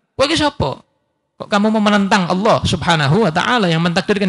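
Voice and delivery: ordinary talk, nothing marked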